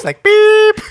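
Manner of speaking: high loud beep; censor beep. Retold or imitated. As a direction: imitate a censor bleep. Imitating censor bleep.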